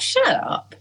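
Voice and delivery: high, indignant "shut up"; a touch of playful outrage; mock snapping back. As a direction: brush the friend off, half-joking. Retorted to friend in thought.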